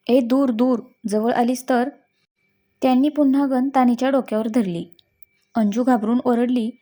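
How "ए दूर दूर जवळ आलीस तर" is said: ordinary delivery, nothing marked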